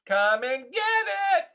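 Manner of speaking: high loud holler. A narrator's reading. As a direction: call everyone to eat. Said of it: "hollered Froggy".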